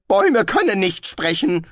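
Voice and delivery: high, loud nervous interruption; nervös. A narrator's reading. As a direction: cut in nervously, pitched high. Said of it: "unterbrach ihn Rincewind nervös". (nervös und hoch dazwischengerufen)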